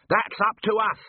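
high-pitched, aggressive comeback; heated robber's retort; snarling retort. A narrator's reading. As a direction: throw it back at him, pitch jumping up. Hostage-taker snaps back at Carrot.

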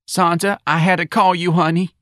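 high, urgent character voice; distressed mother. Acted. Mrs. Riley phoning Santa for comfort, distressed. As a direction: reach out to a friend, pitched high.

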